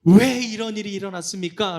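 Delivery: mildly acted loud complaint; confronting God. Israelites arguing with God, loud protest. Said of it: "라고 하나님께 따집니다".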